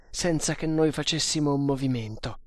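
ordinary delivery

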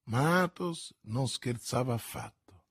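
ordinary delivery

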